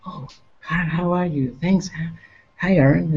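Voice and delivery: lightly voiced shy guitarist, soft and polite; a hint of a quiet, humble greeting; soft-spoken imitation. Imitating a soft-spoken guitarist greeting a fan. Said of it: "It's like, like that".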